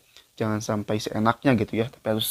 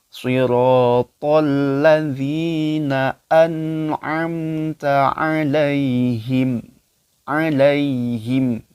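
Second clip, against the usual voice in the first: slow, louder melodic Quran recitation; 3 semitones higher, 6 dB louder, much slower than usual. Acted. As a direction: chant the verse slowly like tilawah. Recites verse. (bacaan tilawah pelan dan lantang)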